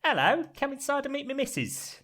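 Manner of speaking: high-pitched Cockney creature voice; squeaky, chirpy Cockney greeting; little Cockney worm. Acted. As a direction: invite them in as a tiny Cockney worm. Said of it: "quite a good Cockney accent".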